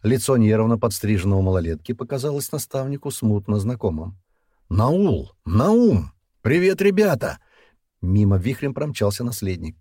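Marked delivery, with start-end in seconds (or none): 4.7-7.4 high, loud hail; calling out a greeting. A narrator's reading. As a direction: call out to the boys, pitch climbing. (громкое приветствие высоким голосом)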